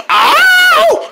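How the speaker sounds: very high, loud "ow" scream; comic scream. Acted. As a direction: shriek it out like a stock movie scream. Sudden scream, then asked about it.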